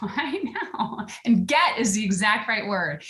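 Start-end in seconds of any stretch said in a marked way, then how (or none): 0.0-1.1 soft laughing whisper. Real, not acted. Humbled, laughing softly.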